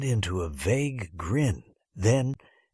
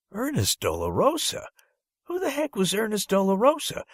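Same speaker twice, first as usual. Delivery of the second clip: much higher, incredulous rising question; baffled disbelief; 12 semitones higher than usual. A narrator's reading. Boy hearing an unknown name in roll call, incredulous. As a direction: react as if the name makes no sense.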